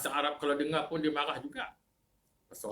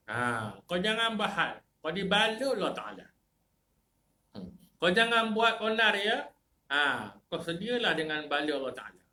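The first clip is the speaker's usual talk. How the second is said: loud, high scolding of a troublemaker; preacher scolding the sinner harshly; harsh rebuke; 6 semitones higher, 4 dB louder than usual. Acted. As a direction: bark the warning down at them. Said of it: "kasar bunyi".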